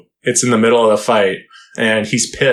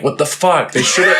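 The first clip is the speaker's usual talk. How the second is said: higher, indignant mock shout; mock outraged yell; 5 semitones higher than usual. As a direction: blurt it like a fan cheated of a fight. Fan outraged at unfair stoppage.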